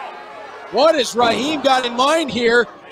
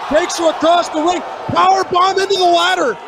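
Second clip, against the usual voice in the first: excited play-by-play; 6 semitones higher than usual. Real, not acted. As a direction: yell the big spot. Powerbomb onto ladder.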